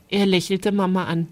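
ordinary delivery

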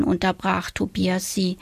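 ordinary delivery

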